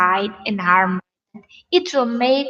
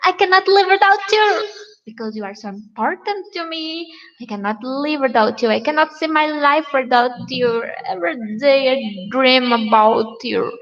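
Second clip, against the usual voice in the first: higher, louder, gushing love talk; mock lovesick gushing; 3 semitones higher, 4 dB louder than usual. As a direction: overdo the smitten lover. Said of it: "You cannot talk like that".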